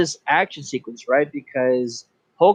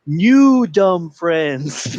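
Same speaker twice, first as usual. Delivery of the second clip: very slow, drawn-out creature voice; slow, lumbering character impression; King Shark; 3 semitones higher, 4 dB louder, much slower than usual. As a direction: stretch each word like a simple-minded brute. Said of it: "He goes".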